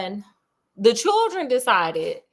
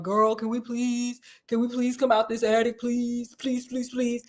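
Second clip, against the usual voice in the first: put-on begging kids; 4 dB quieter than usual. Children beg their mama to leave the attic, soft and pleading. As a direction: beg mama over and over, softly. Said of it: "begged their mama".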